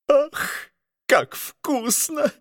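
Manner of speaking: high, delighted cry of pleasure; delighted exclamation. A narrator's reading. Duke praising the pie to the prince, delighted high cry. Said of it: "воскликнул он".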